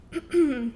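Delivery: clears throat mid-sentence; quick throat clear. Real, not acted. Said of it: "excuse me".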